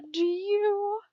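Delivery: tentative question left dangling; trailing off. A narrator's reading. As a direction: let the question hang unfinished. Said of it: "Steve trailed off before he could finish the question".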